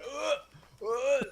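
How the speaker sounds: high retching ugh; mock gag noise; disgusted groan. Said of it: "And I was like".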